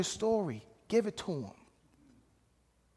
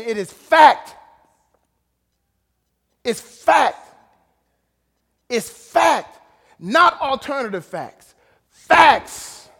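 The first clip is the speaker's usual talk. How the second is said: loud, high, slow declarations; full-force preaching; 4 semitones higher, 10 dB louder, slower than usual. Acted. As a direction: hammer the truth home from the pulpit.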